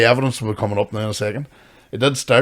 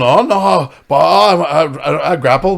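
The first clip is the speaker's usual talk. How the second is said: higher, louder stammering send-up; mock stammering backpedal; 4 semitones higher, 6 dB louder, slower than usual. Teasing his co-host about dodging the fight, mock stammer. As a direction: stutter out the excuse like a scared boy. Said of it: "So you started going".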